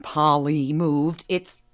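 normal talking voice

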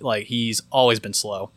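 plain reading voice

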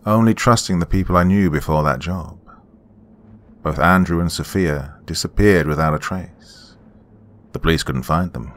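ordinary delivery, nothing marked

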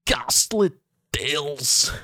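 high, strained Scottish ghastly; Scottish accent. Retold or imitated. Friend mimicking the channel name, high mock Scottish. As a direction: stretch the words like a Scottish storyteller. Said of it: "when I do a Scottish".

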